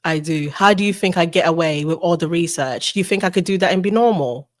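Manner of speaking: low, composed put-on British voice; calm British accent. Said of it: "so much calmer when she's British".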